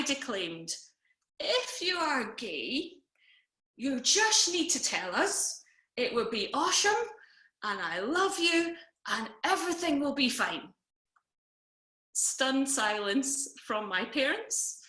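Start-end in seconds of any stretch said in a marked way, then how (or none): 1.4-10.7 put-on slurring drunk declaiming. Drunk sister declaims support to her brother at Christmas, slurring. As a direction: declaim it tipsily, drawing out the words. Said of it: "slurring a little bit".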